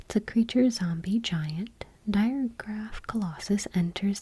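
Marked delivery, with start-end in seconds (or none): none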